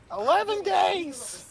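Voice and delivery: high, drawn-out put-on voice; voiced game character. Game character answering how long he has been here, high and drawn out. Graw's acted reply in retold scene.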